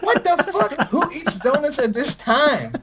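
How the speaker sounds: half-laughing mock outrage, slowing on who eats donuts at this time; laughing disbelief. Finding the donut shop closed by evening, high laughing disbelief.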